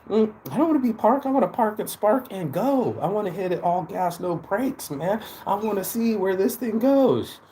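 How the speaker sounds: high, fired-up declaration; hyped up. Real, not acted. Motivational vlogger declaring he's done staying parked, hyped up.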